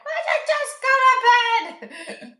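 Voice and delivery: high, slow, loud exasperated wail; exasperated whine. Retold or imitated. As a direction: wail it like a worn-out mom at bedtime. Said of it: "I'm like".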